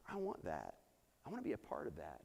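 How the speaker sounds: low, soft and heartfelt; quiet longing. Acted. Visitors looking at a loving church, quietly longing.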